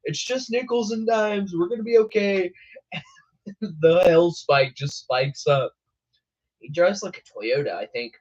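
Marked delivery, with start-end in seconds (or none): 0.1-2.5 high, breezy mock confidence; a higher, carefree imitation of the chaser; imitating storm chaser. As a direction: play the chaser brushing off the hail. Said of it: "he's like".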